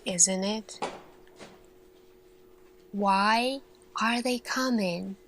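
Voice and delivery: usual speaking voice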